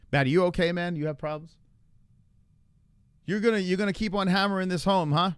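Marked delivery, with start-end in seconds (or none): none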